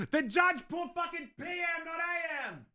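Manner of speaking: high-pitched outburst of disbelief; exasperated shout. Real, not acted. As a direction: cry out in frustration, voice shooting way up. Discovers the judge's mistake on warrant.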